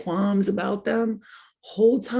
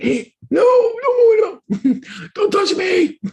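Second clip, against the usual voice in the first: high, loud no no no, then slower; shrieking refusal; 14 semitones higher, 7 dB louder, much slower than usual. Pretend. Comic voice of her body refusing a man, shrieking. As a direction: squeal it out in panic.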